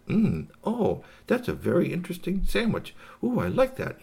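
high-pitched delighted alien character; tickled little space creature; pleased alien. Acted. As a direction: savour it in a high storybook voice. Alien savours the sandwich.

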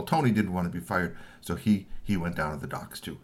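ordinary delivery

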